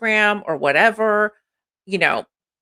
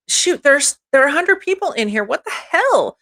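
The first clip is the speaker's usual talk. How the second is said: higher, fast, delighted disbelief; excited surprise; 9 semitones higher than usual. Real, not acted. Streamer sees a hundred viewers in chat, excited surprise. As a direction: gasp it out like the count just jumped.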